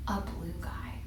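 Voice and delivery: soft, low whisper; whispered reveal. Real, not acted. Whispering the blue guy's arrival to the kids. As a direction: drop to a hush for the reveal.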